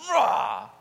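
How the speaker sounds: loud playful rah yell; loud reckless whoop; war cry. Retold or imitated. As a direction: let out a loud gung-ho yell. Yell as he turns hard.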